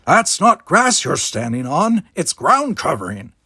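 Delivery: put-on grumpy old gardener scolding. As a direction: tell him off for trampling the plants.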